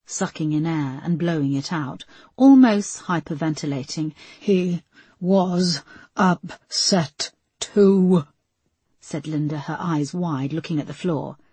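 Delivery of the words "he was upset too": drawn out, nearly breathless; slow, shaky repeat. A narrator's reading. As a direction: Linda repeats herself to Peterson, slow and breathless. Linda near hyperventilating, repeating.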